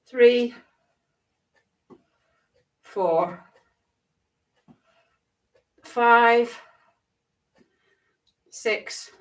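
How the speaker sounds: paced rep counting, each number punched out; aerobics instructor. Acted. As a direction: count the marching reps for the class. Counts reps for the class.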